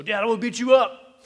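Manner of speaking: put-on high, loud child's voice boasting at dad; tiny kid picking a fight with his father; little kid's threat. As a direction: play the three-year-old squaring up to his dad. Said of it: "They go".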